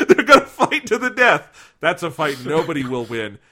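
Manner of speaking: laughing while quoting Brock; laughing mock quote. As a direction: giggle out the line mockingly. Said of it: "mocking the dub line".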